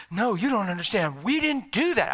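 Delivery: retold tearful protest; near tears. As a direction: insist it, voice climbing, close to crying. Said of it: "he just started to cry".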